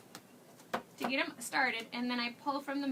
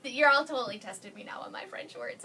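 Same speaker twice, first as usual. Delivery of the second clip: ordinary talk, nothing marked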